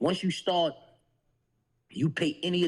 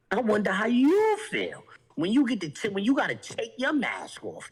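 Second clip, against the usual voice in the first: high-pitched retort to his words; mock retort; 6 semitones higher than usual. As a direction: throw his line back at him, pitched high. Acting out his mocking retort.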